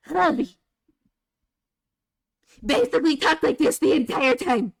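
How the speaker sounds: high, strained little gnome voice; tormenting gnome character voice. Shown as a demonstration. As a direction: squawk it out as an annoying high-pitched gnome. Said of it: "I can do the single line that traumatized my party".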